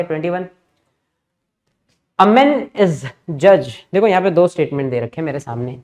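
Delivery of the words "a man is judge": very slow, word by word reading of the statement; slow dictation read. Shown as a demonstration. Teacher reading the question aloud to students, slow and deliberate. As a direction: spell the question out slowly. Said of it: "P क्या बोला है?".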